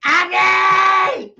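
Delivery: loud high call across the room. Real, not acted. Yelling for Andy from off mic, a loud high call. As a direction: yell the name out loud.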